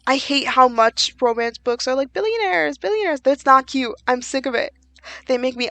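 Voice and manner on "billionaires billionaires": high, sing-song mock swoon over billionaires; mocking billionaire gush. Fed-up reader mocking romance books, high mock swoon.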